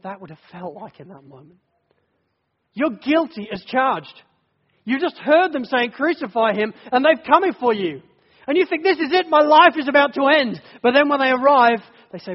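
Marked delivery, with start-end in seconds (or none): 2.7-11.8 high, loud, urgent preaching; fervent preaching. Acted. Preacher putting the congregation in Barabbas's place, loud and urgent. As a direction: press it on the listener with fire.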